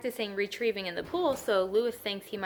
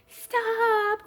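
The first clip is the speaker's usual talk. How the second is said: sharp high shout to stop them; crying out; 14 semitones higher, 7 dB louder than usual. A narrator's reading. Boy shouting at the swim team to stop, loud high cry. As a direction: yell it to halt the swimmers. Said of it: "cried Louis".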